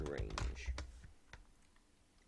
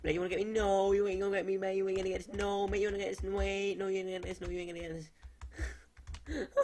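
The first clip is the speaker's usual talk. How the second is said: high loud comic wail of protest; hammed-up yelling, words stretched and run together; panicked refusal; 12 semitones higher, 10 dB louder than usual. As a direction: shout it high like a comic character refusing to be caught. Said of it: "he's like".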